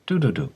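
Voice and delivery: "do-do-do" tapped out as three beats; "do-do-do" as three syllable beats, the first stressed; syllable beat demo. Shown as a demonstration. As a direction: count the rhythm on "do-do-do". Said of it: "has three syllables".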